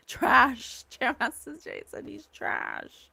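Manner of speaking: loud laugh, then trash said high; laughing burst. Real, not acted. Reader laughing loudly at the spirit's word, half-laughing.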